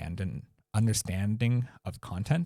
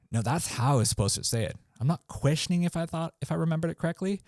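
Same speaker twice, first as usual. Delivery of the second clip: mock uncertain comedian; 9 semitones higher, faster than usual. Shown as a demonstration. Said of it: "If you say a punchline".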